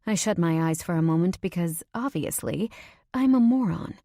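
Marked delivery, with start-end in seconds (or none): 3.1-3.9 self-mocking outburst. Self-scolding burst.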